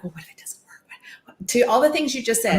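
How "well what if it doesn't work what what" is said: hushed doubting whisper to yourself. Retold or imitated. Talking to yourself in the mirror, whispered doubt. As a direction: breathe it like a nagging inner voice.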